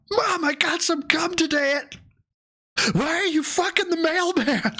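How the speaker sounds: put-on very high childish voice; little kid voice. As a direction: play an innocent little kid. Voicing a kid talking to mom.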